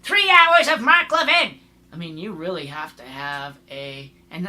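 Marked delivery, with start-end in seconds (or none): 0.0-1.6 loud, high-pitched incredulous outburst; exasperated rant. Real, not acted. Podcaster ranting about listening to Mark Levin, loud and exasperated. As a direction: shout it in disbelief.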